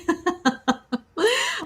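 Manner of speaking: high, soft run of giggles; tickled chuckling; delighted laugh. Real, not acted. Reader delighted by the card drawn, delighted laugh.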